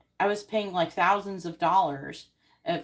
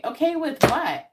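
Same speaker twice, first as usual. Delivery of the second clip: indignant retort; 8 semitones higher, 6 dB louder, slower than usual. Retold or imitated. Arguing with park security.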